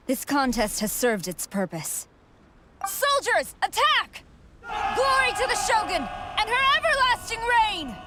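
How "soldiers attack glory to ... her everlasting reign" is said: high, ringing war cry; rousing commander's shout; battle command. Acted. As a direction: shout the order to your troops. Commander orders troops to attack.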